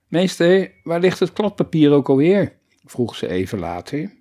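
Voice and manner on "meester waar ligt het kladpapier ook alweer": higher, louder call to the teacher. A narrator's reading. Ellemieke calls the teacher across the class, calling out. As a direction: call out a question across the room.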